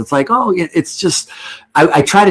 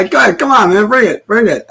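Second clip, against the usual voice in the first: teasing dare; 9 semitones higher than usual. Real, not acted. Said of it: "I'll let you".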